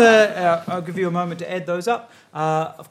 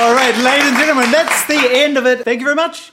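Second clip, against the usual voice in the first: host sign-off; 5 semitones higher, 4 dB louder than usual. Acted. Host signs off the show.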